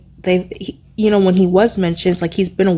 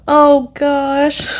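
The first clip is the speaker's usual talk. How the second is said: moved sigh; 6 semitones higher, 3 dB louder than usual. Real, not acted. Moved by last gift.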